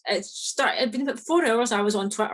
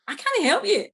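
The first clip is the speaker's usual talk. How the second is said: exasperated; 10 semitones higher than usual. Retold or imitated. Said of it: "And I'm like".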